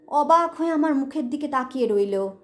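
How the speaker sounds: ordinary delivery, nothing marked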